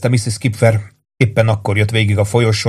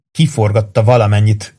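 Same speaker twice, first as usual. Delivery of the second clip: ordinary delivery, nothing marked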